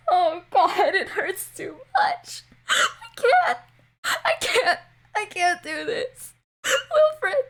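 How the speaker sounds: high, cracking cries of pain and despair; anguished screams. Acted. A tormented soul wailing in the distance, high anguished screams. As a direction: scream it like you're being torn apart, begging.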